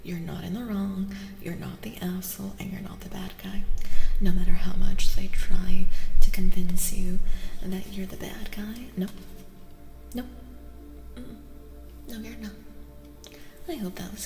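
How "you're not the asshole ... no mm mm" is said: normal talking voice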